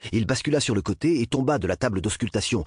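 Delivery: usual speaking voice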